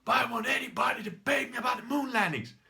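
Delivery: high, loud hammed-up challenge; mock shouting. Imitating a video maker demanding a moon landing debate, shouting. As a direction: holler it like an outraged ranter. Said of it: "the moment that he said, like".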